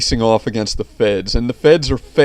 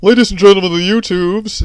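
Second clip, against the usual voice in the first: higher, louder nasal host greeting; nasal announcer; 9 semitones higher, 7 dB louder, faster than usual. Retold or imitated. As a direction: boom it out like a showman. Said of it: "when you do the nasal voice, the deep nasal voice".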